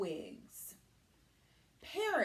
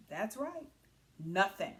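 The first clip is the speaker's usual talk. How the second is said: usual speaking voice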